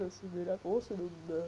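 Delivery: ordinary talk, nothing marked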